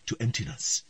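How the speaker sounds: usual speaking voice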